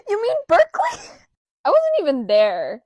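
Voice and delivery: very high, loud squeal; excited exclamation. Real, not acted. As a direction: blurt it out high through a laugh. Delighted reply about a fellow creator.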